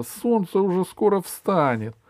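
high-pitched call. Acted. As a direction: call up to someone sleepy. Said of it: "крикнула Алиса". (высокий оклик)

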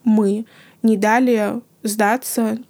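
usual speaking voice

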